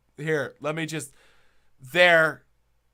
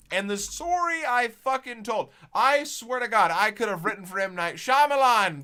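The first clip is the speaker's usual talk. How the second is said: higher, louder hype; excited build-up; 7 semitones higher, 5 dB louder than usual. Real, not acted. Bragging to friends about his lie, excited and higher. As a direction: sell the twist like a showman.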